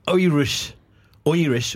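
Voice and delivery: "Irish" said with an opening oy sound; Oirish pronunciation. Shown as a demonstration. Podcaster mocking Orson Welles' accent, "Irish" said as Oirish. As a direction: turn the first vowel of "Irish" into "oy". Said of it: "Irish with an O".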